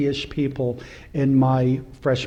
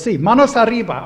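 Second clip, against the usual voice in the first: robber's command; 7 semitones higher, 7 dB louder, faster than usual. Retold or imitated. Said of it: "He goes".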